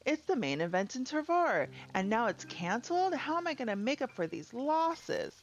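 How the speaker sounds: a hint of an outraged merchant fretting over losses; upset villager. Reads an upset villager's lines.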